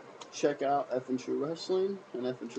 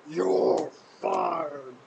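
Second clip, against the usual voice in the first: imagined Triple H; 3 dB louder, slower than usual. Pretend. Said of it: "He said".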